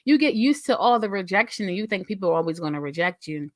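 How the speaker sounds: normal talking voice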